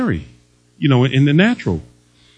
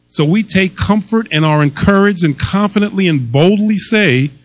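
ordinary talk, nothing marked

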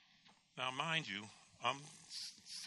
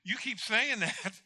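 high, slow, drawn-out complaint; exasperated protest; 7 semitones higher, 3 dB louder, slower than usual. Retold or imitated. Patient arguing with the doctor over his diagnosis, exasperated. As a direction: stretch it out in mock exasperation. Retold back-and-forth with the doctor.

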